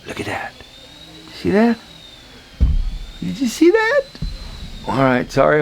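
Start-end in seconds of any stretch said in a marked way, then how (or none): 0.0-4.0 rising, much higher thrilled questions; eager to show off the result; excited. Real, not acted. Instructor to class, thrilled by the balance. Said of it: "I'm getting a little excited there".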